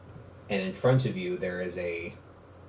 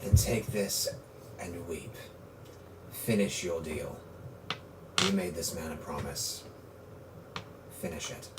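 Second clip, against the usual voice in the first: quiet solemn command; 5 semitones lower, 11 dB quieter than usual. Acted. GM voicing NPC's solemn command.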